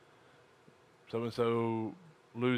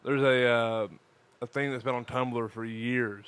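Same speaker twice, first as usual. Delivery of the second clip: ordinary delivery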